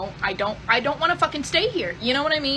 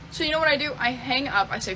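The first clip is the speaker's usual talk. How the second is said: normal talking voice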